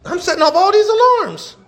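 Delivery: high, rising comic complaint; mock exasperation. Pastor joking about locks beeping around him, mock exasperated. Acts out his exasperated reaction.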